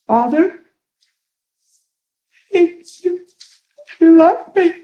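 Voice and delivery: very high, halting mock-tearful voice; imitating tearful woman. Woman repeating a prayer after the minister, imitated tearfully. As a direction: squeak it out haltingly, as if crying. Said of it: "She goes like this".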